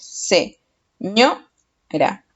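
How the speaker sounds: "Se, ño, ra" in separate syllables, ÑO lifted; teacher sounding out "Se... ño... ra". Shown as a demonstration. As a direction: pause between Se, ño and ra, raising ño. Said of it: "voy a separarlo en sílabas".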